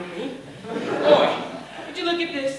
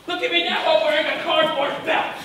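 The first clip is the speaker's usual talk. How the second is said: higher, insistent self-pity; building, raised-pitch complaint; indignant lament; 3 semitones higher than usual. Acted. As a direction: plead your ruin with rising outrage. Same lament repeated, now rising.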